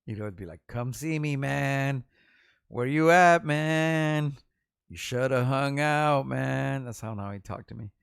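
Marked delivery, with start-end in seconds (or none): 0.7-6.8 louder, higher, drawn-out calls of man; pleading friend's call. Retold or imitated. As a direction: imitate a buddy hollering for you to come over. Friend calling, begging to visit.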